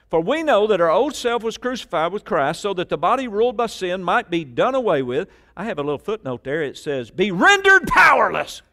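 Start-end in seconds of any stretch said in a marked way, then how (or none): 0.1-5.3 forceful preaching. Real, not acted. Preacher declaring scripture to the congregation, forceful. 7.1-8.6 very loud, high, emphatic shout; all-caps emphasis. Real, not acted. Preacher reading a footnote in all caps, shouted. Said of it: "That was all caps in my notes".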